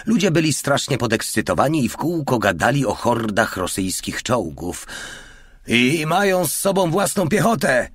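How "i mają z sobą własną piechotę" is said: high-pitched, agitated yell. A narrator's reading. Sergeant warns the old man of infantry, yelling. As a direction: cry out the bad news, pitched way up. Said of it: "darł się do starego jakiś Feldwebel".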